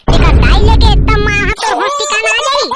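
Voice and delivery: loud, furious threat; angry threat. Acted. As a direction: bark the threat loudly. (गुस्से में ज़ोर से धमकी)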